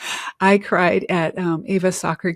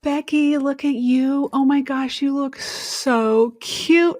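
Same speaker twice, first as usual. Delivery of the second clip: high, gushing delight; excited gushing; 7 semitones higher, slower than usual. Real, not acted. Friend gushing over Becky's new hat, high and thrilled. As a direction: squeal over how cute she looks.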